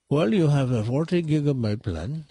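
imitating an Indian-accented phone agent; mock Indian accent. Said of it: "I'm doing an Indian accent".

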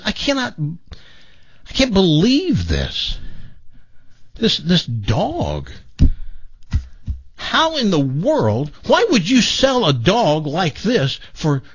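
a stunned buyer pleading in disbelief, slowly. Acted. Stunned buyer asks the owner.

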